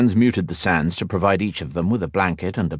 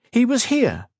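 high, breathless disbelief; astonished. A narrator's reading. Kat stunned that Marcus was there, breathless. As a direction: say it as if it cannot be true. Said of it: "gasped Kat".

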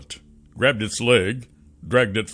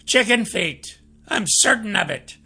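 high, friendly trickster voice; sly and cheerful, pitched up; high chatty shapeshifter; 12 semitones higher than usual. Acted. Gorley bluffs.

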